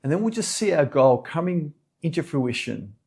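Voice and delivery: calm meditation guide, unhurried with long pauses; soothing yoga teacher leading a meditation; guided meditation. Real, not acted. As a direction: settle them gently into stillness, phrase by phrase.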